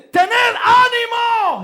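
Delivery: grito agudo, fuerte y alargado; llama a voces sobre la tempestad, muy despacio; Jesús gritando. Acted. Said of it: "Imagínate". (grito sostenido de ánimo)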